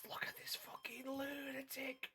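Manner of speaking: incredulous whisper. Real, not acted. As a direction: hiss it in disbelief. Whispered disbelief at buyer.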